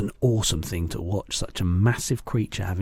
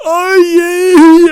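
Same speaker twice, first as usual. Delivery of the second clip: very high, loud drawn-out howl; giant's roar; 15 dB louder than usual. A narrator's reading. Sleeping giant roars as the nightmare starts, huge howl. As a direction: roar out in high-pitched agony. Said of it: "roared the flesh lump eater".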